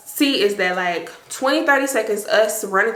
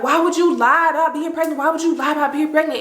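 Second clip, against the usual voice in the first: higher-pitched, mild imitated yell; retold yelling; 6 semitones higher, faster than usual. Friend chasing the liar through the halls, retold yelling. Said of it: "So Trina is yelling".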